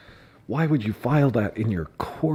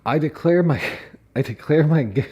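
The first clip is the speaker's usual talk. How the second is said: louder, higher, breaking off into laughter; laughing restart; 6 semitones higher, 6 dB louder than usual. Real, not acted. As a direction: keep trying to read but laugh. Restarting reading, cracking up.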